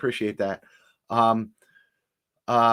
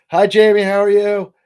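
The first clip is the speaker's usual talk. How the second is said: loud greeting; 9 semitones higher, 12 dB louder than usual. Real, not acted. Greeting a viewer by name.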